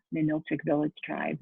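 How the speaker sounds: ordinary delivery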